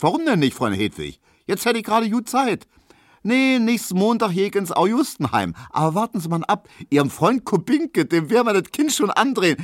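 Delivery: high, cheery call from afar; chirpy young woman. Retold or imitated. Hedwig greeting Tesch from afar, high and chirpy. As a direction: greet him brightly across the square.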